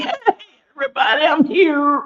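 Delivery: high, loud, hammed-up announcement; attention-seeking double chin. Double chin crashing into the spotlight, hammed-up excited shout. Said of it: "I don't want you to be like".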